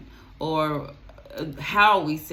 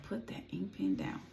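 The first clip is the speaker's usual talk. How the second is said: unvoiced hushed aside; quiet aside; 3 semitones higher, 11 dB quieter than usual. Real, not acted. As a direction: whisper it off to the side. Aside to someone off camera.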